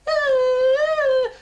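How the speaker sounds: very high, loud squeal; high storybook shriek; frog scream. Acted. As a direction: let out a long piercing acted squeal. Both frogs scream.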